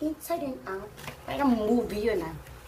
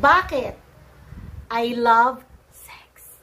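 playful tease; 4 dB louder, slower than usual. Acted. Playful, slow declaration.

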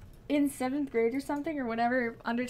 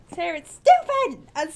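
loud indignant outburst; shouting; 5 semitones higher, 12 dB louder than usual. Real, not acted. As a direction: yell it out in protest. Ranting about Speedwagon's hair.

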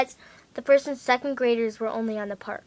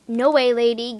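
loud refusal; 9 dB louder than usual. Retold or imitated. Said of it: "And I was like".

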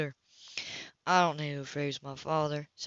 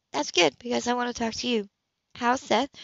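higher, cool woman's voice, caught off guard; higher, cooler woman's voice to Cam at her door; Anna's voice; 6 semitones higher, 3 dB louder than usual. Acted. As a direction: play the composed woman surprised at her door. Anna speaking.

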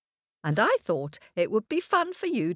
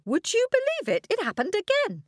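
higher, eager storyteller build; excited; 13 semitones higher, faster than usual. A narrator's reading. Storyteller thrilling the children, high and eager. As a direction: lift it high with wonder.

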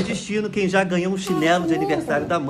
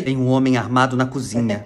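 much lower, put-on grave voice; ominous warning; 10 semitones lower than usual. Someone reporting an armed man in the kitchen, ominous low voice. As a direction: drop it low, like dreadful news.

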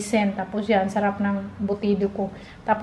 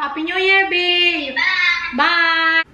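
high bright call-out; excited greeting; 6 semitones higher, slower than usual. Real, not acted. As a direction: greet the family over the phone. Greeting family on video call.

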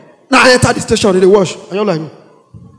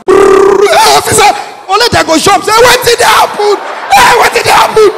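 panicked shouting; 10 semitones higher than usual. Retold or imitated. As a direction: shout like officers fleeing. Panicked officers yelling.

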